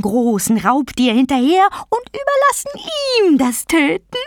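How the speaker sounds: high, shrieky, drawn-out taunting; taunting vulture goddess. Acted. Vulture goddess promising patience to her prey, taunting. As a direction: gloat with big pitch swoops, stretching words.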